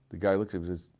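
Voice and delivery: usual speaking voice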